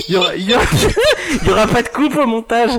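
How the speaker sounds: talking through giggles; trying not to laugh, voice high; stifled giggles. Real, not acted. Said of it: "de rire, on entend". (parler en retenant un fou rire)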